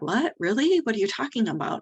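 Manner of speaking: retold high-pitched bewilderment; surprised disbelief. Mom hearing she calmed her baby, surprised. Retold mother's reaction to praise.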